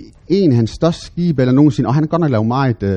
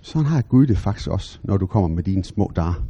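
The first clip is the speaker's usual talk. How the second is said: soft, quiet, tender; 7 semitones lower, 7 dB quieter than usual. Real, not acted. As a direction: speak it gently to the heart. Tender point about God. (blid og stille om Gud)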